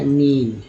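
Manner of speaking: मीन with a stretched "ee". Shown as a demonstration. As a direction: teach मीन slowly with a long "ee". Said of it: "इस तरह से मीन बोलना चाहिए था".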